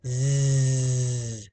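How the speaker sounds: "Z" as a long buzzing zzz; sustained voiced buzz for "Z"; isolated letter sound. Shown as a demonstration. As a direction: hold the "Z" sound, not the letter name. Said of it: "The first sound is".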